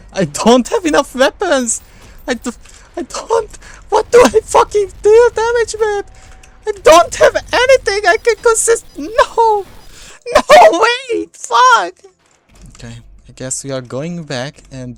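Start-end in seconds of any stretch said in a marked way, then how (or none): 0.1-2.5 frustrated yell. Real, not acted. Weapons run out mid fight. 3.0-11.9 high, loud panicked shout; panicked yelling. Real, not acted. Panics as weapons run out.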